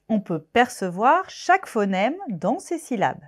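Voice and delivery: usual speaking voice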